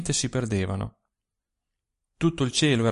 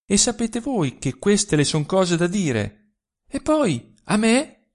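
indignant protest; 8 semitones higher than usual. A narrator's reading. Jeli protests to the father.

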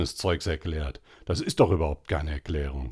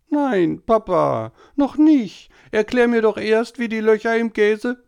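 very high, loud whining scream; screaming boy; 7 dB louder than usual. A narrator's reading. Tobi screaming at papa not to go to bed, very high and loud.

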